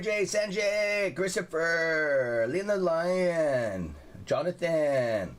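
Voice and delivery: high, slow, stretched-out names; drawn-out greetings. Real, not acted. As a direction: sing out each name to the chat. Greeting viewers by name.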